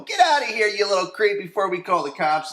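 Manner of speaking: very high, loud shriek at the creep; high, loud yell at the peeping boy; yelling girls. Retold or imitated. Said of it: "the girls see him and they go".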